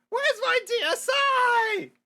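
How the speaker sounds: desperate high wail; high slow shout. Acted. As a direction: cry out slowly in despair. Wakes, wants his game.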